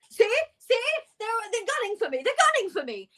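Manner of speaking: high, fast panicked ranting; paranoid outburst. Acted. Pigeon-covered Richard, high and frantic, insisting he's targeted. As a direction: rattle it off like he's being hunted. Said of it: "he's just like".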